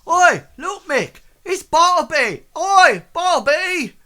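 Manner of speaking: high, loud excited hailing; guard calling out. Acted. Guard spots Bartleby and waves, high excited shout. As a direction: holler across a crowd to someone you know. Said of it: "the other one says".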